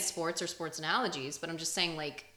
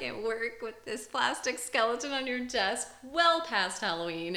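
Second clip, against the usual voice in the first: normal talking voice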